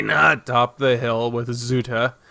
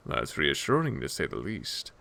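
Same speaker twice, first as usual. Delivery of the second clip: low, soft courteous reply; quiet reassurance; 9 semitones lower, 9 dB quieter than usual. Acted. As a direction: answer gently, dropping lower and softer. Solaire answers her softly.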